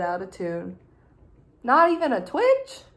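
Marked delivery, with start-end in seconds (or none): 1.6-2.8 higher, louder incredulous question; can't believe they slept through it; disbelief. Acted. Disbelief at sleeping family.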